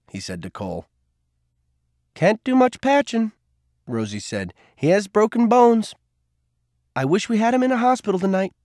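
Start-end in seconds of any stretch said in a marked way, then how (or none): 2.1-3.3 much higher woman's voice; a brisk nurse, pitched high; Rosie voice. A narrator's reading. 4.8-8.5 much higher woman's voice; a brisk nurse, pitched high; Rosie voice. A narrator's reading. Rosie continues.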